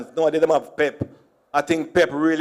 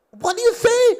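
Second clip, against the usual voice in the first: very high baffled lady. Retold or imitated. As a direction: squeak like a confused clerk.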